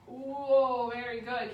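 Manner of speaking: usual speaking voice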